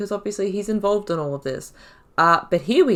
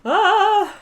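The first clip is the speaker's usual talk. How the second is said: a sudden high yelp out of laughter; a squeal that breaks through the giggling; startled yelp; 13 semitones higher, 6 dB louder than usual. Real, not acted. Gamer yelping while laughing at a dialogue option.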